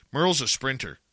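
usual speaking voice

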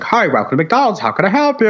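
high, bright-on-demand chirpy greeting; mock-cheerful fast food worker; cheery cashier impression. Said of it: "He did not sound like that".

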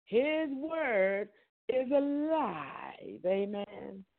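high, drawn-out eerie proclamation; horror movie style. Retold or imitated. Preaching the living word, hammed-up horror-movie cry. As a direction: stretch the words like a horror film. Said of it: "I take the horror off it".

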